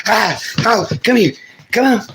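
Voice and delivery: high squealing come-here; excited pet calling. Real, not acted.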